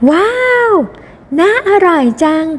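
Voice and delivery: high-pitched admiring gasp of words; delighted wow. Acted. Guest admires the cake, hoping to taste, delighted.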